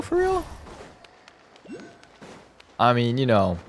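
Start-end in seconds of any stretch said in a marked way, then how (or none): 0.0-0.4 high-pitched incredulous squeak; squeaky disbelief. Real, not acted. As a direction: shoot way up in pitch. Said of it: "Is it him for real".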